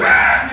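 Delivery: loud, high cry of a church shouter; sudden shout. Retold or imitated. Shouter in church startles the person beside him, sudden yell. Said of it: "he goes".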